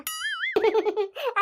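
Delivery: sly gloating chuckle; gloating laugh. Acted. Scheming sister-in-law gloating alone, sly laugh. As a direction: laugh at the trouble you stirred.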